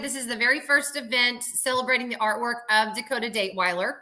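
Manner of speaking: ordinary talk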